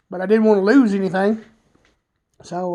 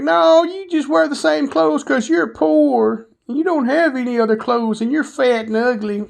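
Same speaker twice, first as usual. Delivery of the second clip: mocking kids; 5 semitones higher than usual. Kids taunting a fat kid.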